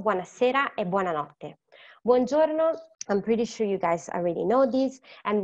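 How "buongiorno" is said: cheerful, louder, higher Buongiorno; bright greeting. Shown as a demonstration. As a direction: greet the class warmly and brightly. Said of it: "teacher presents the greeting".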